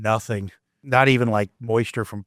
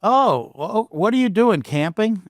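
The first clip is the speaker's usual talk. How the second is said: lightly voiced older stranger calling out high; a hint of an older man calling into the tent, raised and higher; 9 semitones higher, slower than usual. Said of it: "it sounded like an older person".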